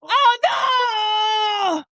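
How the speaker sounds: high pained cry; wailing from the burn; after a horseradish shot. Real, not acted.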